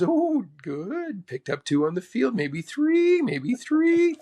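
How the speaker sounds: imitated high sing-song approval, rising on the repeats. Mentor cheers player's birdie, imitated in a high sing-song. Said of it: "He goes".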